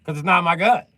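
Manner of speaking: high, loud snapping answer; loud retort. Acted. Defendant snapping back at prosecutor, loud. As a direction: shoot back at the lawyer.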